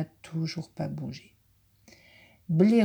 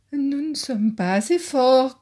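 higher, louder elk voice; observing elk; 7 semitones higher, 5 dB louder than usual. Acted. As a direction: state it plainly as the elk. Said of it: "constate l'élan".

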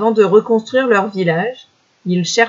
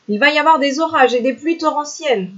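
high, quick foxes' warning; lightly voiced foxes, pitched up and hurried; yapping foxes; 7 semitones higher, faster than usual. A narrator's reading. Foxes warning of torrential storms, high quick yapping. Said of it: "jappaient les renards".

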